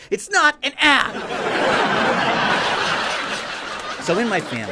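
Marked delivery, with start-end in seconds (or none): 0.1-1.1 exasperated uncle. Retold or imitated. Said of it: "And I'm like". 1.2-4.0 laugh. Real, not acted. Laughs at own joke.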